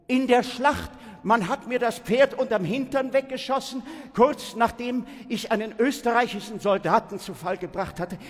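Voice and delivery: loud, high, urgent telling; agitated battle account. A narrator's reading. Pupil recounts the battle to Zeus, agitated. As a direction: pour it out high and loud, building to the blow.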